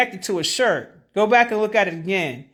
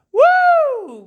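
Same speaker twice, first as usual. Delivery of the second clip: whooping exhale; 5 dB louder than usual. Real, not acted. Exclaiming after laughing fit.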